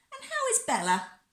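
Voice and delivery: high sing-out lady's voice; cooing neighbour lady. A narrator's reading. Lady next door asks after the child, cooing high.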